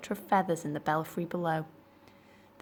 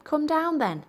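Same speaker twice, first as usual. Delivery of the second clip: calling up; 10 semitones higher, 5 dB louder than usual. Acted. Andrew calling up the tower.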